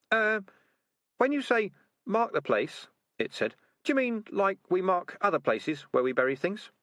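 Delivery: high, unsure squeaky rat; a nervous little rat asking. Acted. Uncertain rat voiced.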